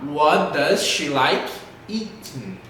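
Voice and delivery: "What does she like eating" slowly, dropping on "eating"; falling question intonation. Shown as a demonstration. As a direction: spell out each word, tune down on "eating". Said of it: "entonação lá embaixo". ("What does she like eating" devagar, entonação lá embaixo)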